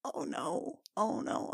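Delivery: breathy horrified oh no; dismayed whisper. Real, not acted. Player dreading the sister's words, whispering. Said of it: "player dismayed whispers".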